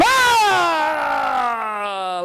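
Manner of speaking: loud, high, drawn-out tiger scream. Acted. Storyteller startles listeners as the tiger, screaming. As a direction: scream out long like a tiger, sliding down. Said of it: "he heard the tiger scream".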